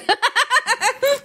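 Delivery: bright high burst of laughter. Real, not acted. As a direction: laugh out at the joke. Laughs at favourite word.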